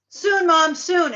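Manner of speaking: hammed-up boy promising his mom; boy's voice, calling out. [name] fakes the boy's voice to the mother, high and loud. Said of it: "[name]'s playing the boy's voice".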